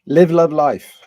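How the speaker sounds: excited. Pretend. Repeating the motto as a flourish.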